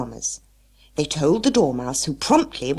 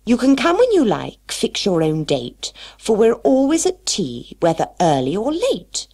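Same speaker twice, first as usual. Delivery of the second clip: louder, rhythmic recited verse; poem recital; 8 semitones higher, 6 dB louder than usual. Acted. Mad Hatter reading his poem to friends, loud and rhythmic. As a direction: declaim the invitation poem proudly. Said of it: "here is my bit of poem".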